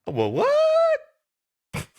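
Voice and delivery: high, loud sarcastic snap; a dash of indignant attitude; snippy well what. Acted. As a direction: fire it off high and annoyed. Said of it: "With a dash of".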